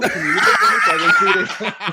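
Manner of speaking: high, laughing plea; laughing request. Real, not acted. Asking the cohost to loop it, laughing high. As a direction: beg through laughter.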